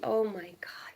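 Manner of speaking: unvoiced awed whisper. Real, not acted. Whispering in awe at a viewer's comment. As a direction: breathe it out in disbelief. Sees a South African viewer.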